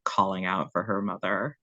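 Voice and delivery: usual speaking voice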